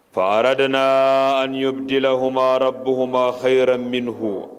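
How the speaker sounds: loud, rapid recitation of the Quranic verse. Acted. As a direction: declaim the verse at full voice and speed.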